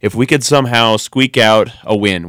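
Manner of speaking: ordinary delivery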